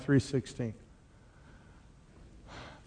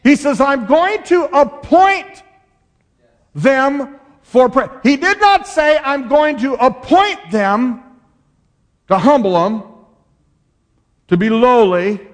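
full-force preaching; 8 semitones higher, 5 dB louder than usual. Acted.

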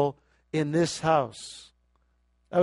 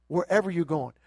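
normal talking voice

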